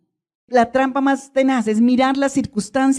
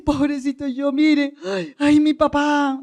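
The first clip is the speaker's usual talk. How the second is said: mock poor-me lament, pitched up; self-pitying victim; 3 semitones higher, slower than usual. Preacher mimicking someone blaming their dad, self-pitying victim. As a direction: wail it like a hammed-up victim. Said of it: "esos papeles de víctima".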